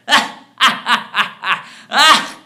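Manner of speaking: loud, high burst of cackling; gloating cackle. Acted. Conscience laughs at the man who failed to kill him, gloating. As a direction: cackle in staccato bursts, trailing away.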